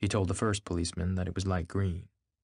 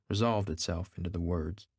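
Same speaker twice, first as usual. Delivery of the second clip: plain reading voice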